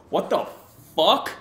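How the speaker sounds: sudden high, loud what the fuck; startled curse at a message; shocked outburst. Real, not acted. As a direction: blurt it out in shock. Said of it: "Sorry, I just cussed".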